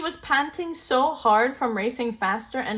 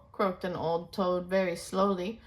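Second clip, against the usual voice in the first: usual speaking voice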